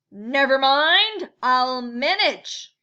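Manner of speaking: slow, loud, rough-voiced boar. Boar announcing himself to the crowded mitten, slow and loud. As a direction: lean on it like a big swaggering boar.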